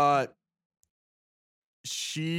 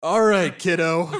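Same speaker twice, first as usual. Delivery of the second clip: put-on a touch louder, like addressing a little kid; talking to a child; 2 semitones higher, 9 dB louder than usual. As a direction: humor her like a five-year-old. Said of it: "like you're five".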